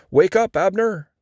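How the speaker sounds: mild shout, pitched high. A narrator's reading. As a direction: call down to rouse a sleeper from afar. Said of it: "he shouted down to the soldiers".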